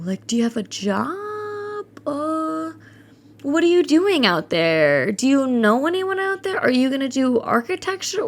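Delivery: mimicking a doubtful relative, high and slow at first; a nosy relative doubting the move, mock condescending; mock condescending questions. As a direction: put on a prying, skeptical tone.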